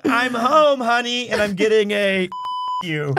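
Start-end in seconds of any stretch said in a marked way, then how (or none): 0.0-1.3 high, loud sitcom "I'm home, honey"; sitcom husband. Retold or imitated. Husband quoting his own greeting, hammed-up. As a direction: ham up the cheery homecoming. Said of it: "I'm saying".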